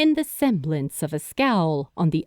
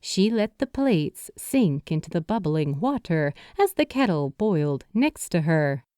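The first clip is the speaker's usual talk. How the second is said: usual speaking voice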